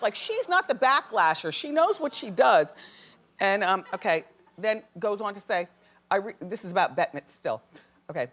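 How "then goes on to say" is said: ordinary talk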